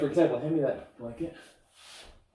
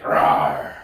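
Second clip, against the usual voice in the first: breathy playful bear roar; playful monster growl; 7 dB louder than usual. Pretend.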